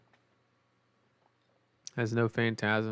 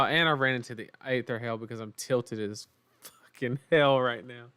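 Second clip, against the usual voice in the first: ordinary talk, nothing marked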